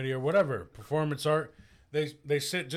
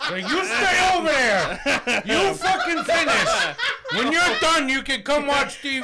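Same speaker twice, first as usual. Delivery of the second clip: bossy wife voice; 10 semitones higher, 3 dB louder than usual. Pretend. Voicing a wife ordering around.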